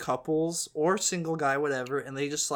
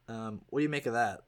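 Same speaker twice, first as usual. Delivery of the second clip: ordinary talk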